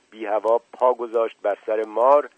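usual speaking voice

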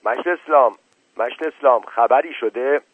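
calls out loud through the hole above the door; shouting. Acted. Mashti Baba shouts through the door hole to Eslam, loud call. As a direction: raise the voice to reach someone outside. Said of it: "داد زد".

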